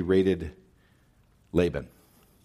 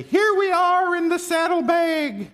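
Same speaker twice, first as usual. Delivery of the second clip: much higher, squeaky little idol voice; mocking tiny idol voice; 3 dB louder than usual.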